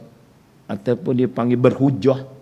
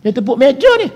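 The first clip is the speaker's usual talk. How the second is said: mock outrage; 13 semitones higher, 7 dB louder, faster than usual. Mocking parliament table banging.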